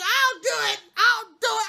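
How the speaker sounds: high, loud and eager; eager volunteering. Retold or imitated. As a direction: jump in like you can't wait to help. Charlie eagerly volunteers to clean.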